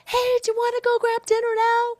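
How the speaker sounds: high, loud call to another room; yelling across the house. Retold or imitated. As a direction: shout it down the hall at him. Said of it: "yell across the house".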